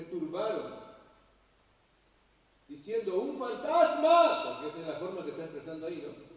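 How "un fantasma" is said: loud, drawn-out frightened cry of ghost; grito de miedo. Acted. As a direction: cry out like you just saw a ghost. Discípulos gritan de miedo. (grito asustado: "¡un fantasma!")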